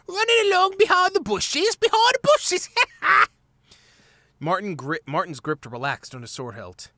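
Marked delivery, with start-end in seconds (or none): 0.1-2.7 very high, squeaky jeering rat voice; mock him in a shrill high squeak; nasty mimicry. A narrator's reading. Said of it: "mimicking his voice in a nasty manner". 2.7-3.3 high squeaky laugh; jeering rat cackle. A narrator's reading. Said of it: "mimicking his voice".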